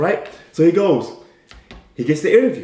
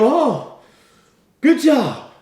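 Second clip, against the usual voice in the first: proud parents; 10 semitones higher, 4 dB louder, much slower than usual. Retold or imitated. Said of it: "And they go".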